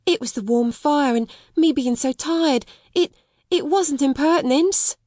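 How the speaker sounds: put-on high, anxious servant girl; pleading maid. A narrator's reading. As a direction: explain herself, stammering with worry. Becky pleading in story.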